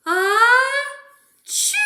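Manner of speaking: big loud mock sneeze. Reader acting the bear's sneeze for kids, loud. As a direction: explode into a high, loud ah-chew. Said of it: "makes the bear".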